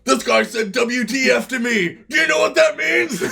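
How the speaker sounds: high, loud scandalized complaint; hammed-up indignant yelling; mock outraged shouting. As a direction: shout like an appalled tattletale. Imitating scandalized player complaint.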